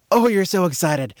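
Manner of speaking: thrilled and bubbling over; acted excitement. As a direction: gush with a child's excitement. Voicing the excited child's joy.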